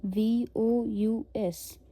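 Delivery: letters वी ओ यू एस read very slowly, one by one; spelled out slowly. Shown as a demonstration. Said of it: "वू".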